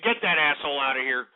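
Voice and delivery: ordinary talk, nothing marked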